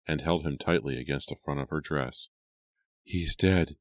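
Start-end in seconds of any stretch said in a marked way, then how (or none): none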